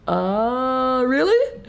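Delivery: put-on skeptical kid. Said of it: "kid looks at us like".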